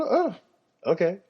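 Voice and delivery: high, sing-song "Oh, okay" playing dumb; mock oblivious okay. Shrugging off kids who think mom is janitor, high and airy.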